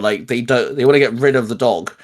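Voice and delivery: ordinary delivery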